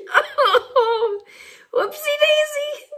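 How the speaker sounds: high, giggling through the word; laughing whoopsie-daisy. Real, not acted. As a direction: let the laugh spill over it. Teller laughing at the mishap.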